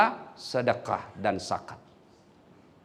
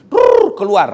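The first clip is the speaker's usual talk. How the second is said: flushing noise; 4 semitones higher, 11 dB louder, slower than usual. Retold or imitated. Toilet flush imitated.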